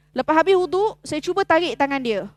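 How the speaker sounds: ordinary delivery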